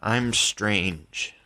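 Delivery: ordinary delivery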